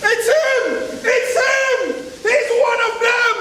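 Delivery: high, loud hateful shout; angry spewing. Acted. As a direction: spew it in his direction. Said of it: "the angry mouth spewed".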